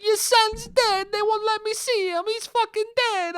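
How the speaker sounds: high-pitched mock Italian hysteria; crazy Italian caller. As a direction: announce it like a frantic Italian caricature. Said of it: "called up the dude's mother and was like".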